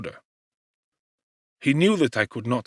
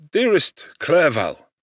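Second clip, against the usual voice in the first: slow, louder, heartfelt exclamation; tender exclamation; 5 semitones higher, 4 dB louder, slower than usual. A narrator's reading. Victor thanks his nursing friend, slow and tender. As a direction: call your friend fondly. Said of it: "exclaimed I".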